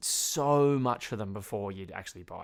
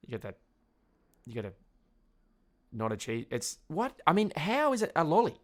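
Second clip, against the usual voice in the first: ordinary talk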